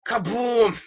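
loud, high mock blast; explosion exclamation. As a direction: boom it out like a cartoon explosion. Imitates an explosion after title.

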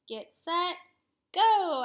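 rising, loud race start; starting call building to shout. A narrator's reading. Kids starting a race, call rising to a shout.

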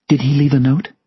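high-voiced grieving woman; Sylvia's voice. A narrator's reading. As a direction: ask in a high, delicate character voice. Narrator voices grieving Sylvia asking.

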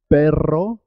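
trilled rr in "Perro"; rolled double R. Shown as a demonstration. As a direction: vibrate the RR of "Perro", ending on a rise. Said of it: "suena doble".

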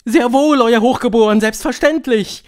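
high, very loud chorus voice; high, loud, servile assent; obedient witch crowd. A narrator's reading. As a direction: answer the leader loudly and eagerly. Witch audience obeys its leader.

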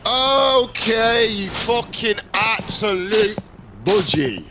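high, loud, worked-up challenge; furious high-pitched gamer rage; angry taunting shout. Real, not acted. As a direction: yell at your rival with drawn-out okays. Rages at another player.

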